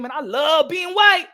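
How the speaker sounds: high, slow, loud proclamation; proud declaration. Acted. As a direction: shout it out with mock pride.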